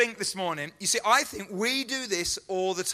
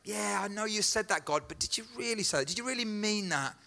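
softer, half-pleading questions to God; quiet bargaining with God; 5 dB quieter than usual. Acted. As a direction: talk God down gently, looking for a loophole. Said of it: "We say".

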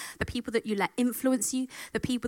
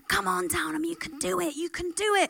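high, bright cheer to a struggling runner; cheering her on; 8 semitones higher than usual. Retold or imitated. Teammates urging Downham up the hill, high cheering.

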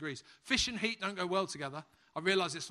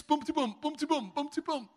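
bouncy higher chant of tummy rumbles; playful rumble effect, higher; rumbling tummies; 7 semitones higher than usual. Pretend. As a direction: bounce the rhythm like a drum. Hungry crowd's tummies rumbling.

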